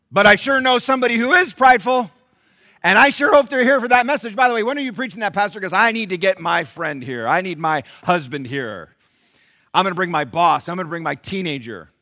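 high, loud congregant pointing the finger; hammed-up shouting, easing a little toward the end; loud mock eagerness. Churchgoer telling the pastor who needs this message, loud mock eagerness.

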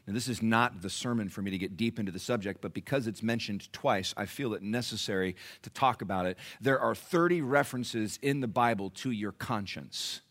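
ordinary talk

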